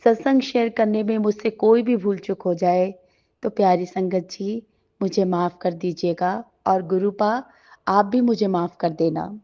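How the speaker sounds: usual speaking voice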